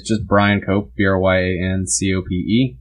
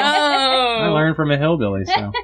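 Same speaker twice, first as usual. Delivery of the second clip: imitating stepdad; 8 semitones higher than usual. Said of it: "He's like".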